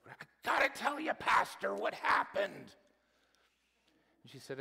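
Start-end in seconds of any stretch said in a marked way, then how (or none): 0.1-2.7 high, loud, excited church lady; excited Lori. Retold or imitated. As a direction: burst out to the pastor, higher and louder. Pastor voices Lori greeting him.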